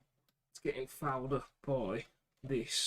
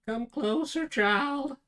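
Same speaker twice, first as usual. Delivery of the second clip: much higher, loud theatrical call; hammed-up summons; 12 semitones higher, 10 dB louder, much slower than usual. Calling a child closer in play, hammed-up loud summons. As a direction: escalate into a big, high put-on voice. Playful summons repeated, far bigger.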